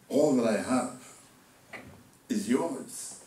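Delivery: restrained, measured fatherly reassurance; gentle assurance. Acted. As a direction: let the promise settle, pausing midway. Same words repeated gently after outburst.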